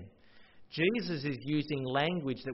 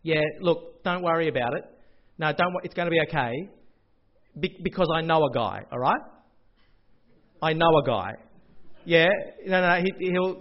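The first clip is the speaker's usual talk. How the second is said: lightly voiced soothing dodgy accountant, louder; hammed-up reassurance down the phone; mock reassuring accountant; 6 dB louder than usual. Accountant soothing an anxious client on phone, mock reassuring. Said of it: "the conversation went something like this".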